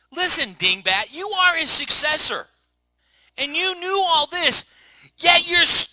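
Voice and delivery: loud, high, slow rebuke; scolding rebuke. Acted. Preacher as Daniel scolding the king, loud and high. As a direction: thunder it down at the foolish king.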